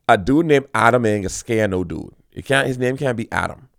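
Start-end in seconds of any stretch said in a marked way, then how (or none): none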